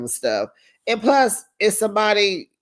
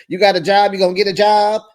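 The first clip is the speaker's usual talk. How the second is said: louder, pushy questioning brother; mock interrogating brother; 4 semitones higher, 8 dB louder, faster than usual. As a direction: press him like a nosy big brother. Brother grilling the boyfriend.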